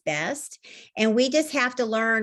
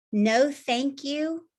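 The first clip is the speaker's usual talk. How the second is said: "no thank you" drawn out slowly, "no" lifted; slow, deliberate no thank you; 3 semitones higher, much slower than usual. Shown as a demonstration. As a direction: let each word of "no thank you" land, unhurried. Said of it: "has ever taught me".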